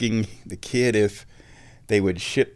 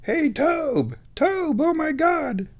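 high-pitched imitation of someone calling out; mock loud call-out; much slower than usual. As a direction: holler a name in retold amazement, very high. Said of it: "being called out very loudly".

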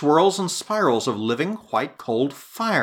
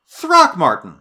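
higher, louder call of his name; alarmed cry; 5 semitones higher, 7 dB louder, slower than usual. A narrator's reading. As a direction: cry out to wake him. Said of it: "I cried".

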